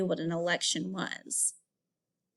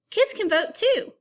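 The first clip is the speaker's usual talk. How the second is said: bright, higher and louder read-aloud; excited; 10 semitones higher, 4 dB louder than usual. Acted. Teacher reading to her class, excited and higher. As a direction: make it sound like exciting news for kids.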